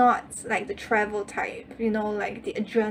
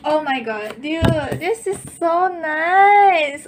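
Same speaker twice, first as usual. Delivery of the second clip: higher, louder, slow delight; thrilled; 6 semitones higher, 6 dB louder, slower than usual. Real, not acted. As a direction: gush over it loudly. Thrilled by the card.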